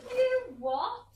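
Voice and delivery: very high, loud disbelief; shocked shriek. Acted. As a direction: explode in outraged shock. Mother hears son burnt house down.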